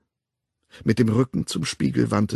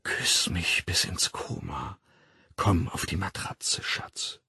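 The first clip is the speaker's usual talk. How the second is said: slow, low, whispered seduction; sleepy murmur; 4 semitones lower, 8 dB quieter, slower than usual. A narrator's reading. Woman luring her lover with closed eyes, sleepy murmur. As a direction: sink into a drowsy, breathy coo. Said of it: "sie senkte die Stimme zu einem schläfrigen Säuseln".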